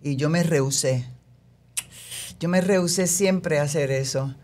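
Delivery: normal talking voice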